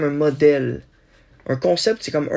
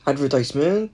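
normal talking voice

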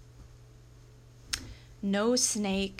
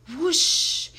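high, loud sweeping whoosh; imitated water whoosh; 8 semitones higher, 9 dB louder than usual. A narrator's reading. Hose stream turned away from master, loud whoosh.

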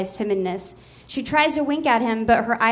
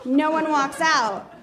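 ordinary talk

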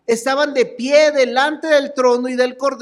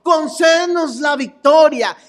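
desperate plea; 4 semitones higher than usual. Retold or imitated. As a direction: beg the Lord for rescue with everything. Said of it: "Esa era la desesperación que decían".

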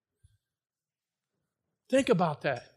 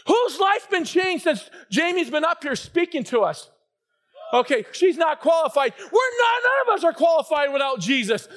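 preaching; 4 semitones higher than usual. Real, not acted. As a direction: drive it home with rising force. Preaching at full force.

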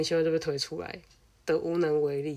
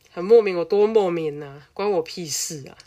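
louder, disbelieving exclamation; exasperated outburst; 7 dB louder than usual. Real, not acted. Telling friends about a forced fight, exasperated loud outburst. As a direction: throw up your hands in disbelief.

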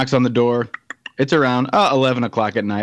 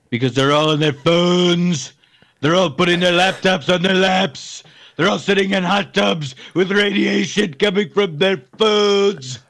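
building, higher put-on ranting voice; mock cranky rant; 4 semitones higher than usual. Complaining about Gen Xers on their phones, mock cranky rant.

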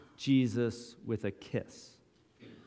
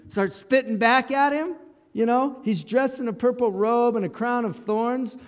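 higher, worked-up "dressed"; heated retelling; 9 semitones higher, 4 dB louder than usual. Shown as a demonstration. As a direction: tell it indignant and rushed. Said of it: "think if it would have looked like this".

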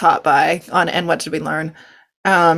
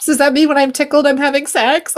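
high-pitched puzzled question; mock childish confusion; 8 semitones higher than usual. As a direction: retell a confused kid's worried question, pitched up. Voices confused younger self's question.

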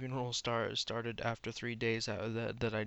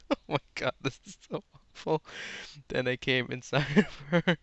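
loud, higher, breaking up with laughter; laughing in disbelief; 3 semitones higher, 6 dB louder, faster than usual. Real, not acted. Reader reacting to awful fan fiction, laughing helplessly. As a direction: groan at the awfulness through laughter.

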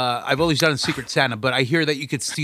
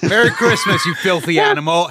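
mock movie quote; 4 semitones higher, 6 dB louder than usual. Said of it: "quoting Home Alone line".

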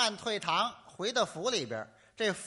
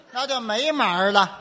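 louder, mock indignant punchline; mock outraged shout; 5 semitones higher, 12 dB louder than usual. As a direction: bellow it in fake outrage. Mock outrage at cut play.

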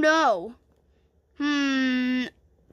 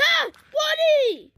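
very high, loud cry; play-acted scream for a friend; pretend panic; 7 dB louder, slower than usual. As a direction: shriek the name in mock alarm. Pretend victim cries out.

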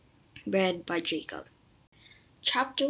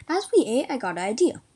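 plain reading voice

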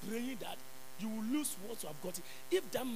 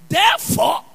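preaching; 10 semitones lower, 12 dB louder, much slower than usual. Acted. Preacher declaims scripture.

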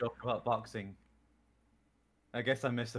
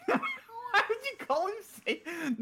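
laughing. Real, not acted. Laughing disbelief.

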